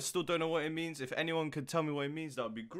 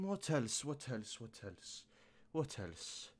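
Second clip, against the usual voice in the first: each repeat softer until barely audible; fading out; 2 semitones lower, 10 dB quieter, slower than usual. Real, not acted. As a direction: let each one trail away quieter.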